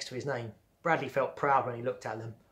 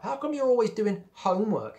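high-pitched, exasperated question; irritated, accusing demand; fed up; 8 semitones higher, 4 dB louder than usual. A narrator's reading. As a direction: complain with scorn on "homework". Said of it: "beginning to get fed up".

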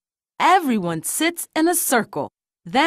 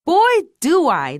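eager, slightly too loud, higher pitch; Louie; 5 semitones higher, 3 dB louder than usual. A narrator's reading. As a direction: pipe up a bit too loudly. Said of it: "Louie says too loudly".

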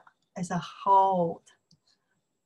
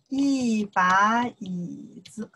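slow, careful teacher demo; slow model reading; much slower than usual. Shown as a demonstration. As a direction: spell the phrase out slowly for learners. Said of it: "Then you know why I use the".

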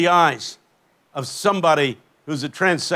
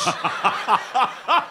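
open, rolling belly laugh; hearty laugh; 5 semitones higher than usual. Real, not acted. Preacher jokes he hoped for ten dollars, hearty laugh. Laughs at his own punchline.

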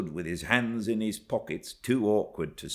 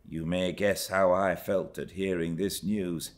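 ordinary delivery

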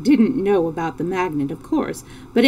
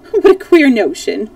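loud, higher amused exclamation; amused cry; 4 semitones higher, 8 dB louder than usual. A narrator's reading. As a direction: cry out as if about to laugh. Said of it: "cried the Fox King, beginning to laugh".

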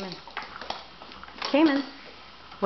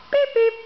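very high, louder honking beep with a falling end; car horn beep; 4 dB louder than usual. Shown as a demonstration. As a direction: honk it out bright and loud for the child. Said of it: "What sound does a car make?".

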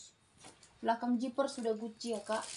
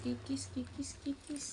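whispered aside, no voice; 2 semitones higher, 8 dB quieter than usual. Real, not acted. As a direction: breathe the words out softly. Berbisik menyebut harga. (berbisik pelan)

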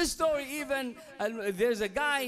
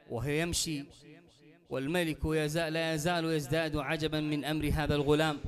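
lower, even Arabic storytelling voice; low sermon narration; 5 semitones lower, much faster than usual. Acted. Preacher recounting the boy and the king to the congregation, low and steady. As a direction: tell the tale steadily, pitched low.

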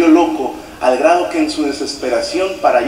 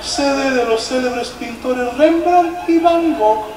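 higher, slow, put-on pompous tour guide voice; fake posh guide voice; 7 semitones higher, slower than usual. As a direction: ham up a snobbish museum guide, drawn out. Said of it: "el guía fingía la voz".

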